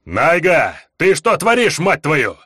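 sharp angry yell; angry shout. A narrator's reading. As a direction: snap at her in anger. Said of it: "злой возглас Рельяра".

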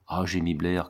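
ordinary delivery, nothing marked

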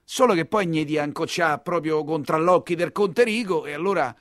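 ordinary talk, nothing marked

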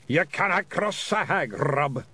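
high Scottish pixie warning; William. A narrator's reading. Piper warning his leader, high cautioning Scots. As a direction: caution the leader in Scots, not really muttered. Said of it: "muttered William".

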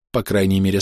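ordinary delivery, nothing marked

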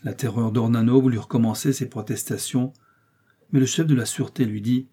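usual speaking voice

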